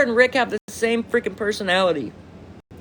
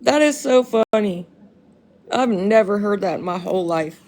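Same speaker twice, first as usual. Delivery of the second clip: ordinary talk, nothing marked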